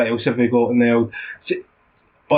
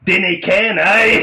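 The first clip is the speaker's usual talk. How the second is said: foreigner's hammed-up take on Scottish; mock Scottish accent; 6 semitones higher, 4 dB louder, slower than usual. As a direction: mimic broad Scots mockingly, high-pitched. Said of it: "You sound Scottish".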